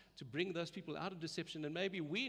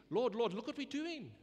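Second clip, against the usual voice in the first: higher, louder, eager cry of "Lord, Lord"; eager plea; 8 semitones higher, 5 dB louder than usual. Retold or imitated. Churchgoers showing off their miracles to the Lord, eagerly. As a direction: boast to the Lord like a proud child. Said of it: "They were actually saying in intimacy".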